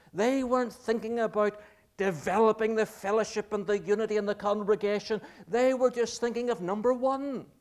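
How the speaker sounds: fervent preaching. Acted. Preacher rebuking selfish Corinthians to the congregation, loud and high. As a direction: preach it hard against the greedy Corinthians.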